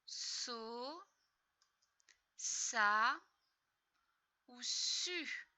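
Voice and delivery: so, sa, su sounded out one by one; syllables modelled for a child; syllables said clearly. Shown as a demonstration. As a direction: sound out so, sa, su with pauses. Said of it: "syllables demonstrated".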